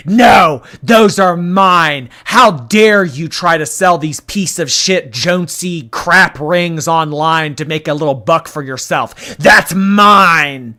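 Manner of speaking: loud, furious, slow at first; furious shout. Retold or imitated. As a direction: yell it like a possessive diva. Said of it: "you know".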